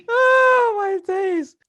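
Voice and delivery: very high, loud squeal; laughing exclamation. Real, not acted. Host cracking up with guest, high laughing exclamation. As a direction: squeal it out after a big laugh.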